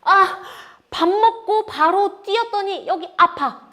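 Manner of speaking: high, loud mock complaint of pain; hammed-up aching cry; acted side pain. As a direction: act out a stitch after running. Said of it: "어디가 아파요?".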